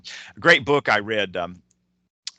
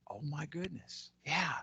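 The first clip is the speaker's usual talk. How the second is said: very soft, raised pitch; hushed realization; 5 semitones higher, 14 dB quieter than usual. Retold or imitated. Reacting to the line from the movie, hushed realization. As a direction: breathe it out as a quiet revelation. Acting out his stunned reaction.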